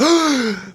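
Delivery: sharp high gasp of delight; a happy little intake of breath. Retold or imitated. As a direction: gasp as if he has just seen her. Trash man sees Susie.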